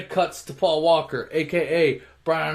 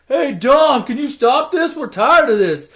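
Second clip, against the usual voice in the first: high, loud mock plea; pleading call; 10 semitones higher, 9 dB louder, slower than usual. Onlookers asking Dom to break up a fight, pleading. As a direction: call out for someone to step in.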